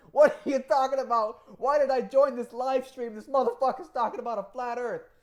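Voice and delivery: loud, high outburst of mock disbelief; freaked-out bystander. Average person reacting to the livestream, loud mock outrage. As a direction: yell it like a baffled viewer. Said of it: "people are gonna be like".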